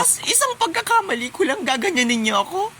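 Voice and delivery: high-pitched tearful complaint; ugly crying. Acted. Teacher reproaches students over one mistake, ugly crying. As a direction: wail it like a wronged martyr. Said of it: "Ang pangit umiyak".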